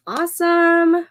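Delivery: ordinary talk, nothing marked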